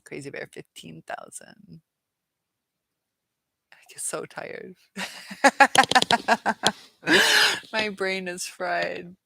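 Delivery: ordinary delivery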